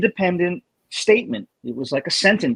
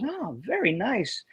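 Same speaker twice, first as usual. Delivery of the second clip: leaned-back teacher; 6 semitones higher, 7 dB quieter, slower than usual. Retold or imitated. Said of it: "he goes".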